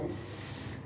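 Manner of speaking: bare voiceless س, no vowel attached; pure consonant sound. Shown as a demonstration. Teacher shows the corrected letter sound, س softly hissed. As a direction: hold س as a soft, long hiss. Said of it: "وقتی تلفظا درست شد".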